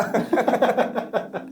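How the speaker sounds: rapid run of high chuckles; chuckling laugh. Real, not acted. Storyteller laughs about the cured child, high chuckling. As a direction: break into a long, bouncy laugh.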